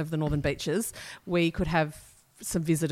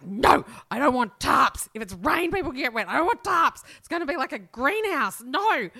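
indignant refusal; 7 semitones higher than usual. Retold or imitated. Her younger self refusing tarps.

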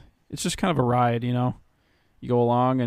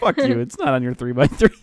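snooty sneering fan voice; high scoff at the plebs; mock snob; 6 semitones higher, 3 dB louder than usual. Mocking a snobby fan.

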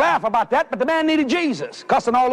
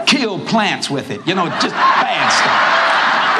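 laughing; 6 semitones lower, much slower than usual. Real, not acted. Laughing through describing bad gumbo.

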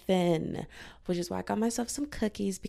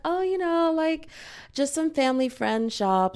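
high, polite, evasive voice; sweet deflection; 8 semitones higher, 4 dB louder than usual. Retold or imitated. Woman evading a stranger's question, high and polite. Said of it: "I was like".

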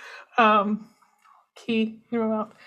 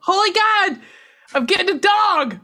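high, loud excited shout; 10 semitones higher, 3 dB louder than usual. Real, not acted. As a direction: burst out with joy. Announcing new puppy.

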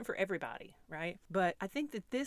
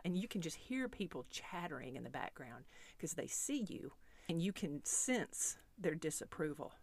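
ordinary delivery

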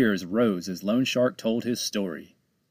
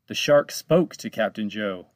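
ordinary delivery, nothing marked

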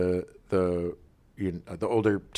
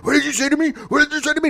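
very high, rattled imitation; hammed-up panicky demand; mock freak-out; 4 dB louder, much faster than usual. Host imitating the shaking comedian confronting a kid, mock panic. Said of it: "And he's shaking".

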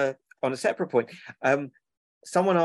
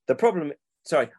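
usual speaking voice

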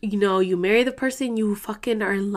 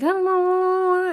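high, drawn-out sing-song mimicry; mock coy reply; 10 semitones higher, much slower than usual. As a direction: imitate a shy dodge, slow and high. Cousin's coy reply imitated.

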